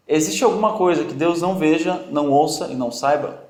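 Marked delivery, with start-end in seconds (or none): none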